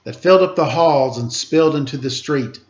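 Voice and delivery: put-on lively read-aloud to children; storybook reading voice; story narration. A narrator's reading. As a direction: read it like a picture book, rhythmic. Reads picture book aloud.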